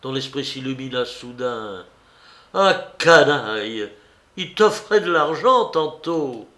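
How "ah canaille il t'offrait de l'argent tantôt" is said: furious curse. A narrator's reading. Marin curses the master.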